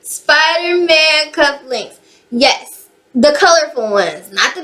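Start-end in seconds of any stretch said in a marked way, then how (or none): 0.0-2.0 each word drawn out slowly and savoured; call-and-response, slow and deliberate; slow emphatic. Shown as a demonstration. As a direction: spell it out slowly for the crowd to repeat. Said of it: "Say it with me now".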